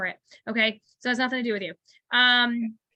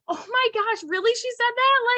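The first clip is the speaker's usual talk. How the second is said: high-pitched, thrilled disbelief; so excited; 11 semitones higher, 3 dB louder than usual. Acted. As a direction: squeal it like you just got a huge compliment. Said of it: "people are gonna be so just, just so excited".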